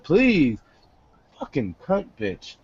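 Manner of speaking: ordinary talk, nothing marked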